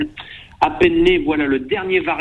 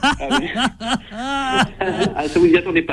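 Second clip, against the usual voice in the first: laughing high and pleased; 8 semitones higher than usual. Real, not acted. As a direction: chuckle through the words. Laughs after his impression.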